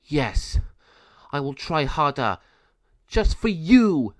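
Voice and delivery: rising to a slow, high, loud threat; angry retort. Acted. Penelo answers the villain, growing angry defiance. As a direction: spit back the challenge. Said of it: "became very angry".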